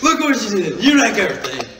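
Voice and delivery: mimicking his girlfriend's complaint; mock whiny girlfriend. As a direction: whine it like she's blaming him. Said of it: "now she's like".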